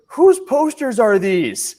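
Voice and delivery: shrill-voiced retold complaint, stretched out slowly; indignant woman. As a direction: imitate the annoyed shopper demanding answers. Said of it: "she goes".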